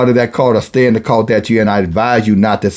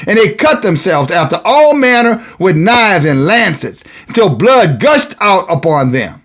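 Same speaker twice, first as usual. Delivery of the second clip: high, ringing scripture declamation; preaching; 6 semitones higher than usual. Real, not acted.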